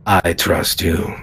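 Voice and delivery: deep, slow, hushed plea; low grave whisper of trust; low intense whisper. Acted. GM voices Flynn's quiet plea.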